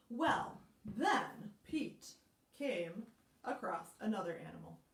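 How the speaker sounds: ordinary delivery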